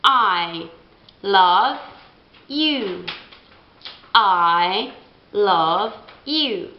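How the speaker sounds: I love you, slow and clear; example phrase. Shown as a demonstration. Teacher modelling I in a phrase. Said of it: "come la prima persona singolare".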